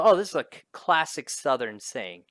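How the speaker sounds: normal talking voice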